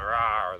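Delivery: a short, loud mock lion roar; playful loud rah. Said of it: "their roar".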